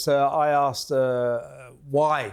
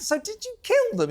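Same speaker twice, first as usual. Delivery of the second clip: incredulous high question. Retold or imitated. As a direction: voice jumps up high in disbelief. Retold question, high far voice.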